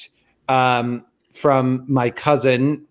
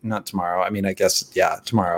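much lower and softer; muttered aside; 8 semitones lower, 5 dB quieter, faster than usual. Real, not acted. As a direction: mumble it to yourself.

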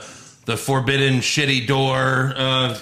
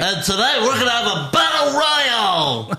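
chirpy mock on-screen host, pitched up; hammed-up, high-pitched event announcer; Michael McGillicuddy; 6 semitones higher than usual. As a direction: play an over-eager show host rising to a big announcement. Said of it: "an on-screen character as Michael McGillicuddy".

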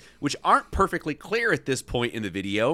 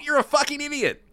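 high-pitched outburst; acted angry insult; 9 semitones higher than usual. A narrator's reading. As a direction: hurl the insult in mock fury. Acted insult in golf quarrel.

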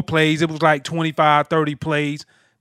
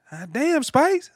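Southern twang; 10 semitones higher than usual. Retold or imitated. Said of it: "Renard had this Southern twang".

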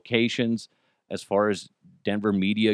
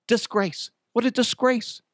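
mock outrage; 11 semitones higher than usual.

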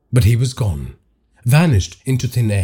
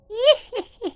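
short, very high, taunting giggle; mocking laugh. Unseen presence mocks frightened Tarek, high taunting laugh. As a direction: laugh at him from the shadows. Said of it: "a mocking laugh".

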